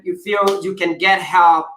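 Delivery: normal talking voice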